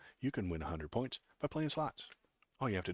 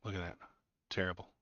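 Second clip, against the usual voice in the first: ordinary talk, nothing marked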